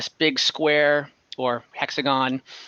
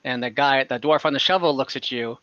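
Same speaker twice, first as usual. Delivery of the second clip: ordinary talk, nothing marked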